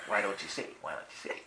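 low, hushed imagined voice; voice in the head. Retold or imitated. Inner voice needling the driver on the way home, low and hushed. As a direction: softly nag like an inner tormentor. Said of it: "this voice in my head said".

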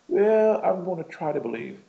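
high-pitched, wishy-washy mock quote; mock tentative believer. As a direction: put on a reluctant would-be believer. Mocking half-hearted belief, then firm.

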